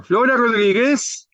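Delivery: high, stretched-out calling voice; como llamando. Acted. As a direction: call out for a missing passenger, rising at the end. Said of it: "Como llamando". (llamando en tono agudo y cantado)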